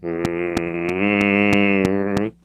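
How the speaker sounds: low, soft, drawn-out hum; imitated horror soundtrack; ominous music hum. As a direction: hum a long eerie movie drone. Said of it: "there's ominous music playing".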